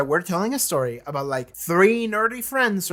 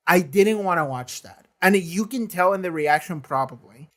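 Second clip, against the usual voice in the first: normal talking voice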